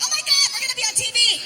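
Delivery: high, loud excited squeal; mimicked freaking out. Two girls hearing the Cops theme, mock excited squeal. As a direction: shriek it like thrilled party girls. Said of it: "they're freaking out".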